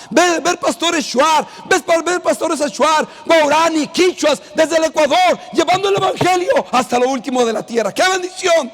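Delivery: fervent preaching. Acted. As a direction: proclaim it with joy to the church. Exultant preaching to congregation. (predicación fervorosa y exultante)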